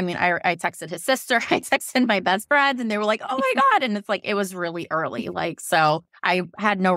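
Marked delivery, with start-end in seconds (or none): 3.3-3.8 excited squeal. Retold or imitated. Friends react to pregnancy news.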